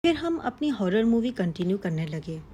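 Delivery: plain reading voice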